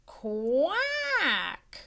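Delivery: duck quack. Acted.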